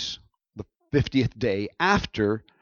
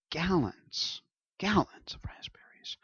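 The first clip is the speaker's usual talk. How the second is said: hushed emphasis; 7 semitones higher, 9 dB quieter, slower than usual. Real, not acted. Marvelling at the big harvest.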